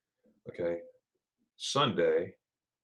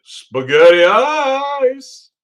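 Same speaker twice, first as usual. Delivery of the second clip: drawn-out delight; 12 dB louder, much slower than usual. Real, not acted. Savoring the dessert name again.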